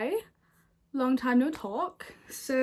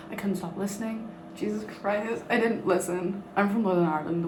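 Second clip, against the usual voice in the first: normal talking voice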